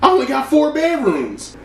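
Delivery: imitating wife refusing him a room; mock Tammy. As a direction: throw shade up high. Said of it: "And she's like".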